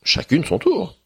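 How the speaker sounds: high, indignant princess voice; a high-pitched huffy rival princess; protesting princess. Acted.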